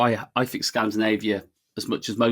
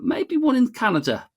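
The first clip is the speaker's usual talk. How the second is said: tentative pitch; 14 semitones higher than usual. Real, not acted. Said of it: "go maybe a little bit controversial".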